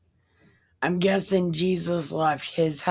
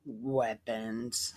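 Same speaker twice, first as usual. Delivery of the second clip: ordinary talk, nothing marked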